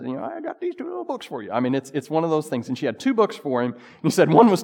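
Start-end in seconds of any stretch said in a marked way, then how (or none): 0.2-1.5 put-on high, quick, sweet little-old-lady voice. Old lady offers the new pastor two books, high sweet voice. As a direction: squeak it out like a tiny sweet grandmother. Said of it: "and she says".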